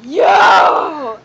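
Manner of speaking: loud furious yell; angry shout. Acted. Big brother catching the thief, angry yell. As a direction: bark it at the little brother.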